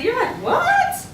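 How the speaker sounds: high, startled "What?"; a sudden lost reaction; baffled what. Retold or imitated.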